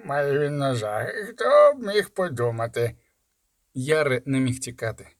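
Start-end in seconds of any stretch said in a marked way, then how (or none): 0.1-2.9 slow, mocking sneer. A narrator's reading. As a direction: drag out the sarcasm. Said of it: "насмішкувато".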